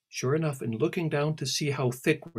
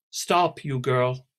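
loud sharp order; commanding; 2 semitones higher, 7 dB louder, much slower than usual. Acted. Celia orders departing Alice to stop, commanding. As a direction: stop her in her tracks. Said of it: "commanded Celia".

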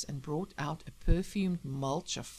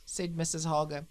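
plain reading voice

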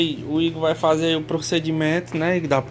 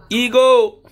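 loud, much higher shout of Igor; calling out; 9 semitones higher, 8 dB louder than usual. Shown as a demonstration. Owner shouting the nephew's name to show the dog reacting. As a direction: yell the name so the dog hears. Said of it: "Eu falo o nome dele".

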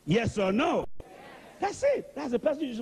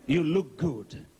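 gentle praise; 6 semitones lower, slower than usual. Retold or imitated. Jesus gently complimenting Doctor Peter, low and tender. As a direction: speak it kindly as Jesus would.

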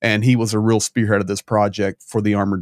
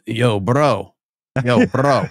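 lightly mimicking a buddy's casual greeting; a hint of Starsky's laid-back yo bro; 2 semitones higher, much slower than usual. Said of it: "Starsky's always going".

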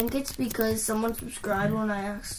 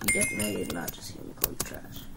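quick muttered aside; 8 dB quieter, much faster than usual. Acted. Quick sarcastic aside to Demon.

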